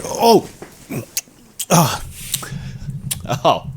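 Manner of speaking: sudden high gasped oh; startled exclamation; surprised oh. Acted. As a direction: caught off guard by the kiss. Surprised gasp at a kiss.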